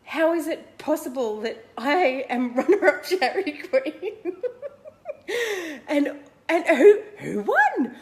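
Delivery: high, loud disbelief broken by laughter; incredulous laughing. Retold or imitated. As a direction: laugh in shock at your own result. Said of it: "My brain having conniptions going".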